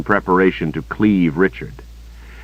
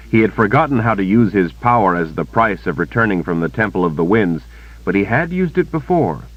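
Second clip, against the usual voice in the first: plain reading voice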